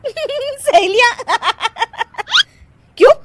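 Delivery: high, drawn-out word bursting into giggles; laughing. Acted. Daughter-in-law hears of mother-in-law's friends, cracks up laughing. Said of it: "तुम फिर से हंस रही हो".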